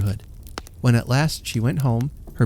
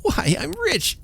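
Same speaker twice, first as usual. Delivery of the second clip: a much higher, thrilled cry of delight; joyful shout; slower than usual. A narrator's reading. Poor washerwoman finding she is rich, shouting joyfully. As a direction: burst out with disbelieving joy. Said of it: "she shouted".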